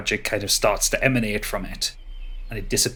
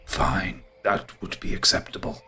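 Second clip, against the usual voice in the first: low, husky ent grumble; soft, voiceless grumbling consent; grudging grumble; 4 semitones lower, 6 dB quieter than usual. Acted. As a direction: huff it out softly and grudgingly. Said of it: "huffs and grumbles and goes".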